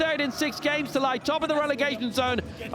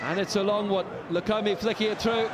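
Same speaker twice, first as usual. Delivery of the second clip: ordinary delivery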